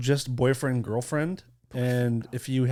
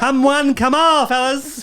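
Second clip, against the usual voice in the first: loud, high hawking cry; carnival barker call; 11 semitones higher, 6 dB louder, much slower than usual. Pretend. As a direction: summon a crowd like a barker. Jokes as if hawking to crowd.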